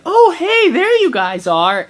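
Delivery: high, loud mock-friendly hello; imitated cheery greeting. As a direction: put on a big, high-pitched greeting. Mom greeting the found group.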